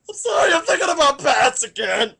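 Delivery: loud, crying outburst; wailing sob. Acted. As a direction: bawl the apology, hammed-up. Crying shout.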